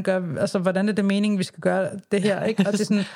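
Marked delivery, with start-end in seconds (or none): none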